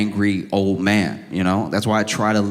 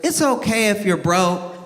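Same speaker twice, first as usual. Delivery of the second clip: put-on little girl's sassy jab; 9 semitones higher than usual. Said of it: "she goes".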